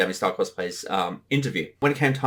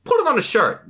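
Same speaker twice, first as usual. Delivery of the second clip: squeaky mock voice; 13 semitones higher than usual. Mock excited suggestion, high.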